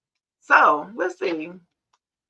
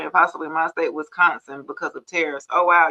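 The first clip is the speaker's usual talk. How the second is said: ordinary talk, nothing marked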